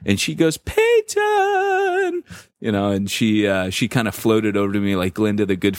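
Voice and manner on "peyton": much higher, delighted sing-song call of his name; gushing greeting. Retold or imitated. Elder's wife calling out warmly to the pastor, high delighted call. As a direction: greet him like a beaming friend.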